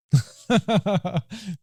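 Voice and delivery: short high burst of laughter; a quick laugh after owning up to being stubborn; real laugh. Real, not acted. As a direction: laugh at yourself, amused.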